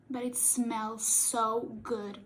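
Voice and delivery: ordinary delivery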